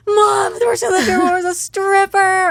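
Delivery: put-on high, loud child's big announcement; scandalized kid. Son tells mom about the stripper, high and loud. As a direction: blurt it out, shocked. Repeating kid's line.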